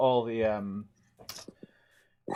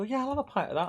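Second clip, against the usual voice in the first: much higher, eager order; eager punter; 12 semitones higher, faster than usual. Retold or imitated. Host voicing himself ordering a pint, much higher. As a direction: chirp the order like a happy customer. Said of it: "But then you go".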